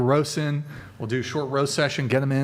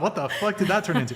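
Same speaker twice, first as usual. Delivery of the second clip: ordinary talk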